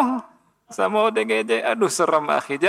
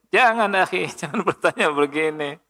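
laughing scold; 5 semitones higher than usual. Real, not acted.

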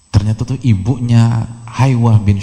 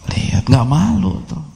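mock voice; 6 semitones higher than usual. Mock voice in story.

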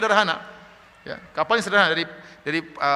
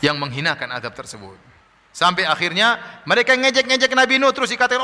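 ordinary talk

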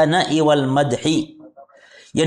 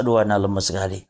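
feigned weakness; 8 semitones lower, 3 dB quieter, faster than usual. Retold or imitated. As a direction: lower voice, quick and showy, faking being worn out. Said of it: "menampakkan badan lemas".

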